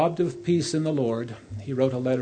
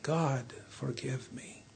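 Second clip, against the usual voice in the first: a broken, near-breathless plea, much softer; sobbing prayer; 9 dB quieter, slower than usual. A narrator's reading. King begging God's forgiveness, fading to a faint sob. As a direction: let the plea fade almost to nothing. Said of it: "and sobbed".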